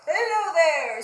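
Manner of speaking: loud, high proud greeting from Pig; Pig's cheerful hello. Acted. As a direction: call out hello like a show-off pig. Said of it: "said Pig to Parrot".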